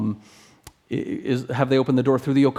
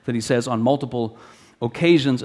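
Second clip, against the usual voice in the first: ordinary talk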